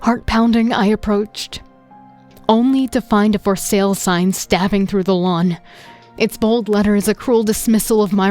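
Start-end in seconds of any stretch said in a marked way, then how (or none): none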